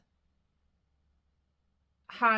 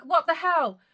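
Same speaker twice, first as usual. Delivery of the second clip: exasperated outburst; 7 semitones higher, 8 dB louder than usual. Retold or imitated. Exasperated outburst at book ending.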